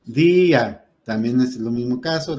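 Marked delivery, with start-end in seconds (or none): 0.0-0.9 "Día" with a long, loud í; DÍÍÍ-a, punched and stretched; stress on the Í. Shown as a demonstration. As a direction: hit and hold the í in "Día". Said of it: "la fuerza de la voz cae en la I".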